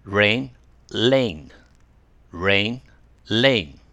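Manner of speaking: Rain with a curled R, lane with a tongue-tip L; R and L minimal pairs. Shown as a demonstration. Teacher drilling rain and lane. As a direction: swap R and L clearly. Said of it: "はい、一緒に。".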